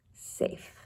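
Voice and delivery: a soft unvoiced whisper of Safe; whispered echo. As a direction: breathe Safe. like a fading dream echo.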